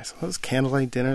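normal talking voice

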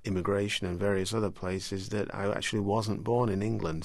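usual speaking voice

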